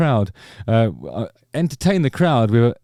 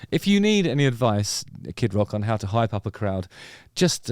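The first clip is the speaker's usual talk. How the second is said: ordinary talk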